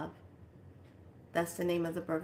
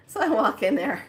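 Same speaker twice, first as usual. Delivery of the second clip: ordinary talk, nothing marked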